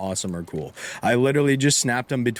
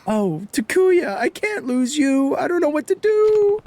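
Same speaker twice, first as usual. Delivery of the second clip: put-on a much higher, louder voice, panicked over her missing child; mother voiced high and louder, frantic with worry; high distraught mother; 4 dB louder than usual. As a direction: wail it like a mother who just lost her boy.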